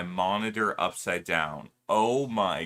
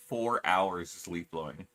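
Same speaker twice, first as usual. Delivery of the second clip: normal talking voice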